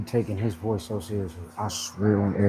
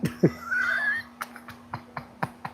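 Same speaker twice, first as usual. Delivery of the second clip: high shrieking laugh; 3 dB quieter than usual. Real, not acted. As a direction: crack up completely.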